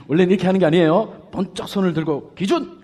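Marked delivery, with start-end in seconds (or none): 2.4-2.8 high call with arm raised; short high shout; shouted command. Shown as a demonstration. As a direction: call out like the line marker. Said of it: "이렇게 하잖아요".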